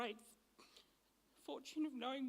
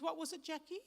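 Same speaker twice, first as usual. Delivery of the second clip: ordinary delivery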